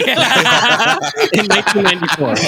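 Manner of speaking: talking through big laughs; high, laughing while speaking. Real, not acted. Laughing at James Cameron joke.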